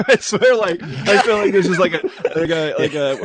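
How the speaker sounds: laughing. Real, not acted. Laughing at being set up.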